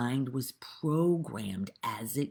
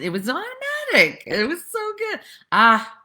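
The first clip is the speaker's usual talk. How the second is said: much higher, lifted gushing praise; thrilled recollection of the film; excited gushing; 9 dB louder than usual. Real, not acted. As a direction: rave about the movie, voice way up. Raving about a funny movie.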